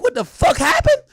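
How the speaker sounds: high, loud shocked burst; shocked disbelief. Retold or imitated. Streamer seeing the news, a high shocked yelp. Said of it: "I'm like".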